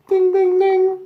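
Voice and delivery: very high, loud sung ding ding ding; bell imitation. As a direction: ring it out like a boxing bell.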